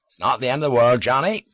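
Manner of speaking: put-on hearty Devon countryman's accent; broad Devon accent. A narrator's reading. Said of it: "Charlie still had a Devon accent as broad as Dartmoor".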